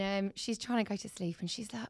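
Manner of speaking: ordinary talk, nothing marked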